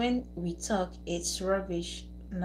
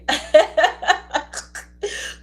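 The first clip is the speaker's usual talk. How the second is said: loud high laugh; 7 semitones higher, 7 dB louder than usual. Real, not acted. Streamer laughing at a troublesome viewer, loud laugh. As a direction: burst of laughter.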